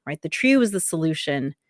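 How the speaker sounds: normal talking voice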